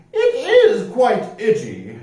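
high, slow, prim voice; un-piratelike rascal. A narrator's reading. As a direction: answer very properly, nothing like a pirate. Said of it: "answered the man".

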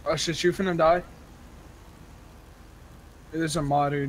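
ordinary delivery

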